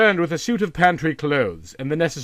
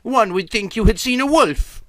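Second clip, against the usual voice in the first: aunt's teasing question; 9 semitones higher, 4 dB louder than usual. A narrator's reading. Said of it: "said his aunt".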